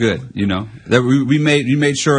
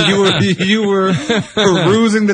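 laughing while talking; 8 semitones higher than usual. Real, not acted. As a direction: talk through a laugh. Teasing co-host, laughing.

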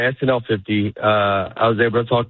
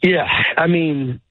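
usual speaking voice

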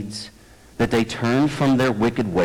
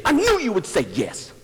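mock accusation; 8 semitones higher than usual. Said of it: "It's like proposing and saying".

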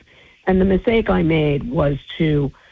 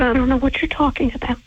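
higher, quick, snippy mock denial; mocking Anne; 7 semitones higher, faster than usual. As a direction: play her innocent denial as a send-up. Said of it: "She said".

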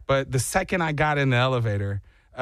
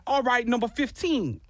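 attempted Jersey accent; 7 semitones higher than usual. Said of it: "I can't do a Jersey accent".

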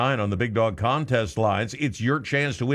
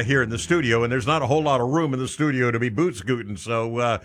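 ordinary talk, nothing marked

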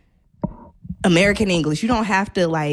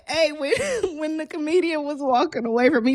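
laughing; 10 semitones higher than usual. Real, not acted. Laughing while retelling a story.

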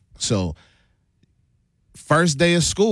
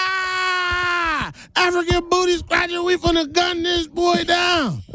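much higher, mocking shout; high hammed-up bully shout; jeering classmates. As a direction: crow the insult like a bully. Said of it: "classmates jeering".

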